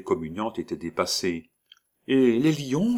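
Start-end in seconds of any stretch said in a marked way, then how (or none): 2.1-3.0 put-on higher child's voice, curious; small girl asking eagerly; little girl. Little girl asks about the lions, higher child's voice. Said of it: "fit l'enfant".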